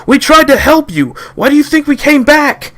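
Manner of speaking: high, loud indignant shout; angry protest. Acted. The boys to the armed guards: high indignant shouting. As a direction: throw it back at them.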